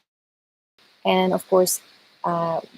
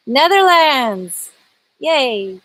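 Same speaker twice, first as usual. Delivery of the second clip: stretched-out, raised cheer; excited cheer; 6 semitones higher, 4 dB louder, much slower than usual. Real, not acted. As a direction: cheer the country slowly and loudly. Cheering a viewer's country.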